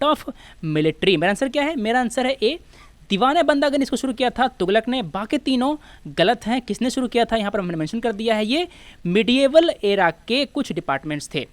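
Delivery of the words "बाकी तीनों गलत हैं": usual speaking voice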